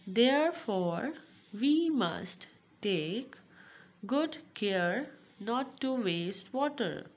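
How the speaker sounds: slow, deliberate classroom reading; read-aloud lesson. Acted. Teacher telling pupils not to waste water, read-aloud. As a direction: read it out phrase by phrase, with pauses.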